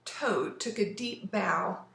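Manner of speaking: usual speaking voice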